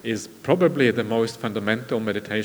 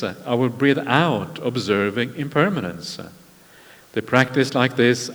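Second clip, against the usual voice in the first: measured, louder reciting voice for scripture; sutta recitation; 5 dB louder than usual. Acted. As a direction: intone each line like a teaching. Recites the sutta passage aloud.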